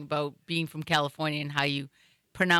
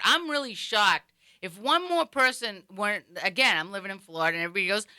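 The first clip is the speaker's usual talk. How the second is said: ordinary talk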